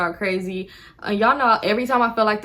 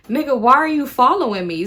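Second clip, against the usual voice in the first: raised, higher exasperated question; exasperated shout; 5 semitones higher, 5 dB louder than usual. Real, not acted. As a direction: call him out, loud and high. Outraged aside to the follower.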